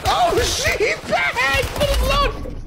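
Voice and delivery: high alarmed shout; surprised yell. Real, not acted. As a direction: yelp in sudden alarm. Sudden alarm, opponent revived.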